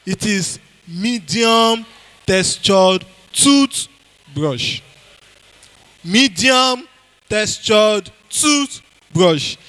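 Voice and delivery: medium textured toothbrush drawn out slowly; slow drilled answer. Shown as a demonstration. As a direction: spell out the answer word by word for the class. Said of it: "teacher slows to drill the answer".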